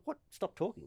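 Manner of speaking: soft, high-pitched disbelieving question; retold incredulity. Player echoing umpire's order to stop talking, incredulous. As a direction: echo the order as if you can't believe it.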